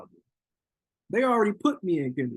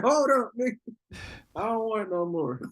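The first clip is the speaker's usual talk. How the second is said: startled backing out; 7 semitones higher than usual. Retold or imitated. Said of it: "I was like".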